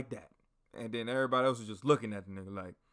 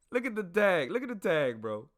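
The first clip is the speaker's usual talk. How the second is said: high, loud exasperated insistence; mock exasperation; 8 semitones higher, 6 dB louder than usual. Soldier points a comrade to his uniform tag, mock exasperated. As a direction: act out insisting to a clueless buddy.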